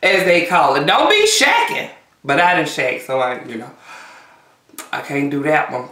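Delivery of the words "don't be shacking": scolding elder. Retold or imitated. Quoting old-fashioned scolding.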